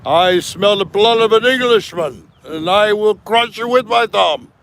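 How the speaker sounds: booming high storybook giant. Acted. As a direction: bellow it like a fairy-tale giant. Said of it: "[name] had the best voice for the giant".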